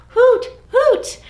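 big playful owl hoot. Shown as a demonstration. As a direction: hoot it high for the kids. Modelling the owl call.

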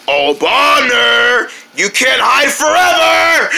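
slow sing-song threat; scary Tun. Acted. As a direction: stretch it out, rising and menacing. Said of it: "not the scary Tun".

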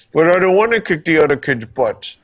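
put-on a light childlike whine; Mulan impression. Imagining Ming-Na Wen quoting Mulan, a touch of whine. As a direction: play it like a reluctant kid. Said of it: "I want her at some point to go like this".

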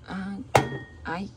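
normal talking voice

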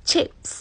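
chips said crisply; British word. Shown as a demonstration. Teacher modelling the British word chips. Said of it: "อังกฤษจะใช้ว่า".

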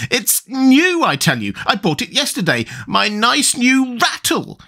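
high tantrum squeal, stretched at the end; fury rising to scream. A narrator's reading. Tweedledum mourning his new rattle, screaming fury. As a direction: climb to a high, drawn-out mock scream. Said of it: "His voice rose to a perfect scream".

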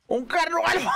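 high-pitched laughing panic; alarmed laugh. Real, not acted. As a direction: laugh in shocked disbelief. Ve un carnotauro alfa. (risa nerviosa y aguda)

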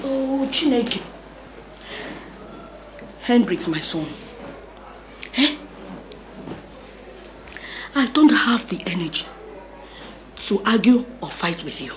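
weary and sad. Acted. Weary mother guilt-trips her son.

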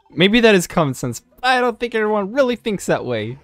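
high, put-on voice of protest; arguing kid character. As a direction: argue back as a high-voiced youngster. Voices game character's dialogue.

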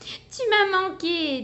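a touch of sweetness; tender. Acted. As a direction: say it hugging him. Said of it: "dit-elle".

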